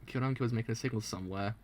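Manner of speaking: ordinary talk, nothing marked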